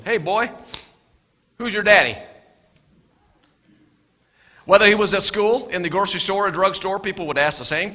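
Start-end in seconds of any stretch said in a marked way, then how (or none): 0.0-2.2 louder mocking call; a hint of a sneering local; taunting. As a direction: jeer at the boy. Taunting townsfolk question.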